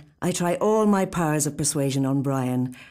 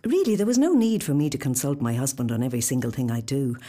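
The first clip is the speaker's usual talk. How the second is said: ordinary delivery, nothing marked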